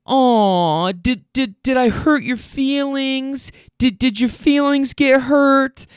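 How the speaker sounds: put-on high, drawn-out baby-talk taunt; mocking pity. Woman taunts driver at gas station, mock baby-talk pity. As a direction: coo in fake sympathy, slow and high.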